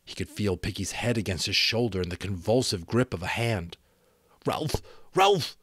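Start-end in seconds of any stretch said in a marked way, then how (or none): 4.5-5.6 rising, higher urgent call; calling out in fear; frightened cry. A narrator's reading.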